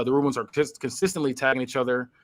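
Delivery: ordinary talk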